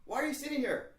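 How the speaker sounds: high, loud questioning voice; puzzled dean. Retold or imitated. Dean to a student waiting in the office, high and puzzled.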